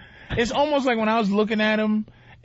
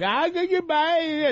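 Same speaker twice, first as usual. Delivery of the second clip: high, drawn-out character farewell; Borat goodbye; 10 semitones higher, slower than usual. Retold or imitated. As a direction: stretch out a high, goofy foreign goodbye. Imagining Borat leaving in character.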